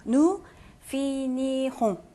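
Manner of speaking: Nous finirons slowly, syllable by syllable; read as written. Shown as a demonstration. Teacher says 그대로 읽으시면 돼요, slow reading model. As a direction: sound out Nous finirons with drawn-out, separated syllables. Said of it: "그냥 그대로 읽으시면 돼요".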